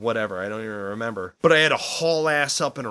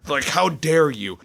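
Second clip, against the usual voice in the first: ordinary delivery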